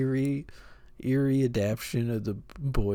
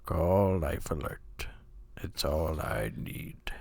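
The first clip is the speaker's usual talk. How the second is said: low, slow mock narrator; Morgan Freeman impression; 6 semitones lower, 5 dB quieter, slower than usual. Friend does a low, slow mock narrator impression. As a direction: drag it out low and solemn. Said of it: "Morgan Freeman impression".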